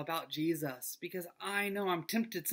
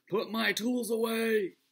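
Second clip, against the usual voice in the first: stern dad order; 4 semitones higher, 6 dB louder, slower than usual. Retold or imitated. Dad scolding kids about tools.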